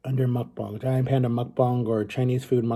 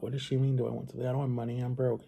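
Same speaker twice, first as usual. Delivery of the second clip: muttered softly to himself; quiet inner thought; 10 dB quieter, faster than usual. Retold or imitated. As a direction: think it quietly, puzzled.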